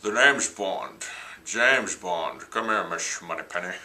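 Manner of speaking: slow, slurred-S Scottish Bond voice; hammed-up Connery-style Scottish accent; Sean Connery impression. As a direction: do a suave Scottish secret agent, taking it slowly. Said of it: "That was my Sean Connery impression".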